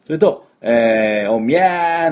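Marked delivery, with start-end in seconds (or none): 1.3-2.1 drawn-out "おみゃー" with the English short-a sound; Nagoya-style "ya" vowel. Shown as a demonstration. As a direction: stretch "おみゃー" into a long, open "æ". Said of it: "おみゃーの音にVです".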